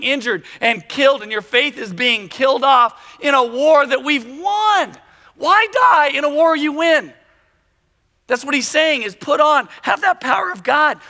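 loud, high, driving sermon delivery; fired-up preaching. Real, not acted. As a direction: preach it at full force, drawing out the key words. Preaching at full force.

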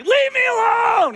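high shouted plea, drawn out slowly; yelling. Retold or imitated. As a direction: yell it up at the eagle, slow and high. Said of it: "He starts yelling".

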